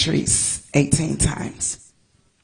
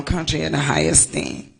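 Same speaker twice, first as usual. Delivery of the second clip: ordinary talk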